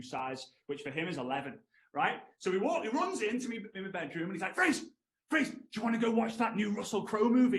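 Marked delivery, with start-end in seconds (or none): 4.5-7.6 put-on high-pitched, eager call to his kid; excited dad. Dad rushing into his child's bedroom with a plan, excited. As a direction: imitate Dad bursting in, high and loud.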